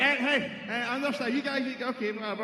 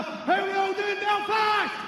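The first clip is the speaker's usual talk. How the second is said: much higher, louder shout to the arena; louder crowd call; 7 semitones higher, slower than usual. Acted. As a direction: call out to the whole crowd for a bigger cheer. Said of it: "Do you want me to do it again?".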